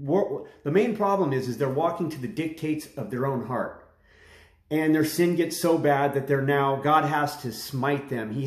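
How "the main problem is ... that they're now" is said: ordinary talk, nothing marked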